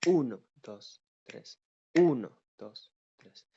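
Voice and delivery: "uno" loud and forceful, "dos, tres" almost whispered; strong one, soft two three. Shown as a demonstration. Teacher demonstrating triple time, loud "uno", soft "dos, tres". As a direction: lean on "uno", then "dos, tres" suavecito and subtle. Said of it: "os digo uno, me la afinco ... dos, tres, suavecito".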